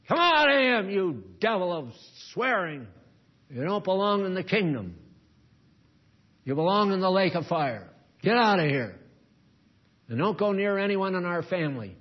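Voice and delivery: high, loud command casting out the devil; forceful deliverance prayer; loud rebuke. Acted. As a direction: cast it out, no mercy. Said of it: "you have to tell them".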